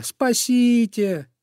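high slow drawn-out wail; desperate cry. Acted. Bunny wails to be saved, high and drawn out. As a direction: plead to be saved. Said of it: "закричал зайка Петя".

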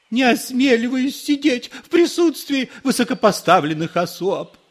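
very high, loud obsequious plea; bow and scrape with your voice; grovelling priest. A narrator's reading. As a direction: priest fawning before important people.

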